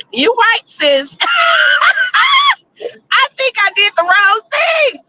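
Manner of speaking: high laughing admission. Real, not acted. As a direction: laugh at yourself. Laughs at a viewer's comment.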